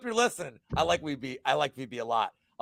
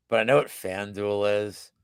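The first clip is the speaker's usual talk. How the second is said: ordinary delivery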